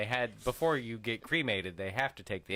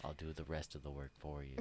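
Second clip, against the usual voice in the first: low, hushed seductive coaxing; soothing; 8 semitones lower, 13 dB quieter than usual. Acted. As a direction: lull them, soft and low. Mock seductive coaching.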